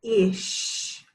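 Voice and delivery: long F sound, pause, then "Ish"; word in parts. Shown as a demonstration. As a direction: sound out the onset before a separate "Ish". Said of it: "say a word in parts".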